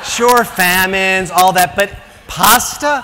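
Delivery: comic indignation at the pasta news; drawn-out mock outrage; incredulous outrage. Real, not acted. Outraged over pasta shortage.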